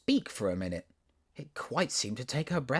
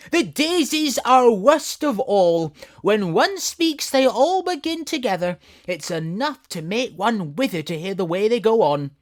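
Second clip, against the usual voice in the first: grand proud flower voice; tiger lily voice; 12 semitones higher, 10 dB louder than usual. Acted.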